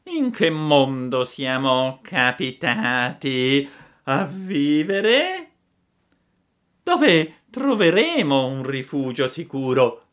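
slow, loud, drawn-out fox lament; a crook posing as an honest man; hypocritical fox. Acted. (la volpe ipocrita si lamenta, voce lenta)

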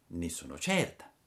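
ordinary delivery, nothing marked

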